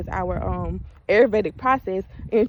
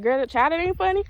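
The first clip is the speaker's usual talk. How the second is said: high, mock-scolding grandma; grandmother's scolding; 9 semitones higher than usual. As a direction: play the grandma scolding, pitched up. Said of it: "she'd be like".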